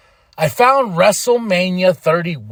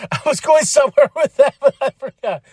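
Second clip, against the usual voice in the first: laughing; 9 semitones higher, 4 dB louder than usual. Real, not acted. Laughing at forgetting his point.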